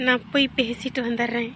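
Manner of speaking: soft, bashful excuse; shy. Acted. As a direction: slip away with a quiet, embarrassed excuse. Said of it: "பேசுறதுக்கு வெக்கம்".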